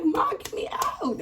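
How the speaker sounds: high, drawn-out whiny begging; reenacted high wail; mock desperate plea. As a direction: stretch it out, pleading to mom. Begging mother while handcuffed, reenacted.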